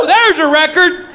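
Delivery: high, drawn-out celebratory shout; excited cheer. Acted. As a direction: cheer a new record loudly and slowly. Imagined crowd cheering his record.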